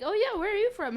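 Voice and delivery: high, eager mock small talk; retold chatty question. Retelling chat with Australian stranger.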